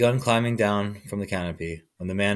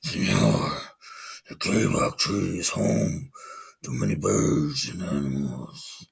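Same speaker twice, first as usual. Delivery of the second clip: much lower, slow growling voice; 6 semitones lower, 5 dB quieter, slower than usual. A narrator's reading. Jaguar growling in the sleeping man's ear. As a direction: growl it low like a big cat. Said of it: "He growled in his ear".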